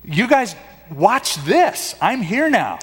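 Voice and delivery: mock cheery rescuer. Imagined Jesus arriving with Kleenex to stop the crying, mock showy. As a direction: put on a breezy here-I-am voice. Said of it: "Jesus would show up with the Kleenex box and say".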